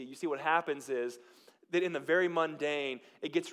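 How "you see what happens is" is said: usual speaking voice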